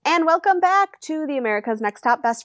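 high, stretched-out welcome; sing-song host greeting. Pretend. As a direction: croon the hello like a showman. Sing-song show opener greeting.

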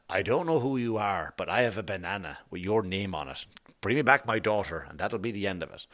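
low, hushed action-hero threat; quiet menace in a mock movie-star impression; terrible Liam Neeson. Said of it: "That was a terrible Liam Neeson impression".

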